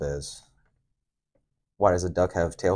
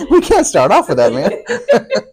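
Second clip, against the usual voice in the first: laughing through the words, louder; 13 semitones higher, 12 dB louder, slower than usual. Real, not acted. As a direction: crack up mid-sentence. Laughing after teasing remark.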